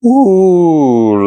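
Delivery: delighted ooh. Real, not acted. Smelling the pie.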